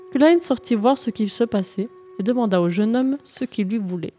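ordinary delivery

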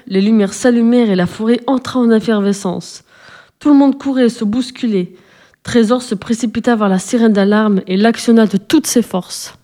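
ordinary delivery

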